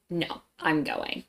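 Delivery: slow, understated refusal; direct, firm. Retold or imitated. Daughter defying Dad over the trip, quietly firm. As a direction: stand your ground without raising your voice. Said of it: "I was very direct with it".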